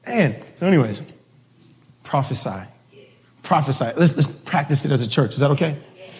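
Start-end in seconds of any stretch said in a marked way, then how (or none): none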